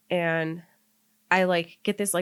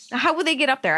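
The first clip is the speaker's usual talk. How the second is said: higher, louder disbelieving challenge; incredulous pushback; 8 semitones higher, 6 dB louder, faster than usual. Real, not acted. Storyteller to friend doubting the windows, incredulous pushback. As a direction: protest it, pitch jumping up. Pushing back on friend's break-in questions.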